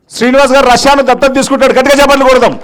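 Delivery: loud, high announcement to the crowd; calling for applause. Real, not acted. Speaker announces a volunteer's pledge to the audience, rallying loudly.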